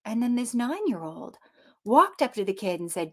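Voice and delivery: ordinary delivery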